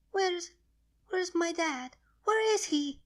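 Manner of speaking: put-on high little child's voice, frantic; a frightened small child calling for her father; panicked baby dragon. Baby dragon wakes and panics about her dad, frantic child voice.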